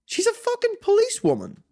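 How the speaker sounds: 아주 높은 목소리로 분노하는 척; mock outrage. Outraged she's a cop.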